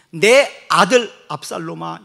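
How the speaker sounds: loud, slow wailing call; anguished father's cry. Acted. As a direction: cry out for the lost son. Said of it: "이렇게 썼습니다".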